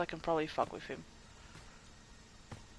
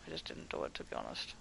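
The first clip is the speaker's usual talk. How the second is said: muttered aside; 12 dB quieter than usual. Real, not acted. Muttered aside while hiding.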